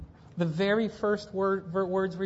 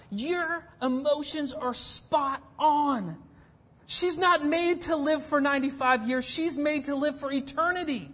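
fervent preaching; 8 semitones higher, 4 dB louder than usual. Acted. Preaching builds again.

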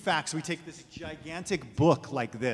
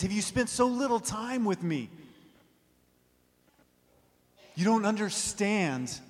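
higher, impassioned reply; Jesus pleading back; 5 semitones higher, 3 dB louder than usual. Retold or imitated. Jesus answers people demanding answers, impassioned and high. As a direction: plead with them to see. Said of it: "And he says".

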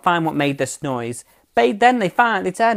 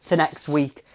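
ordinary talk, nothing marked

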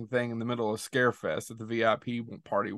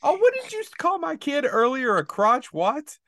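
mock outrage; 13 semitones higher, 5 dB louder than usual. Real, not acted. Teasing outrage at nickname.

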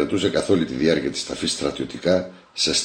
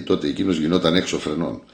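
usual speaking voice

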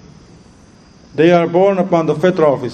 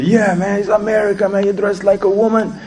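higher-pitched jeering kid, imitated; mocking teenager; 6 semitones higher than usual. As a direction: imitate a heckling teen in a higher voice. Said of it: "Then one of them said".

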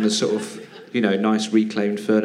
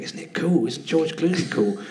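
higher, slower fanboy gush; starstruck gushing; 6 semitones higher than usual. Retold or imitated. Chatting with fellow actors about Clooney, starstruck. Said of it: "talking to the other British guys going".

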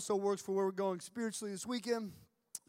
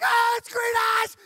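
loud high terrified yell; panicked scream; 13 semitones higher, 11 dB louder than usual. Retold or imitated. Waking up in the tent, panicked scream. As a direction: scream like the monster's here. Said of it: "so I'm like".

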